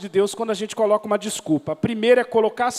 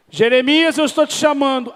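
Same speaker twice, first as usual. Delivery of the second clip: higher, louder voice of God calling out; 6 semitones higher, 6 dB louder than usual. Acted. God summoning Jeremiah, a raised calling voice. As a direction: call him by name, firm and loud.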